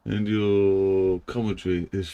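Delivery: ordinary talk, nothing marked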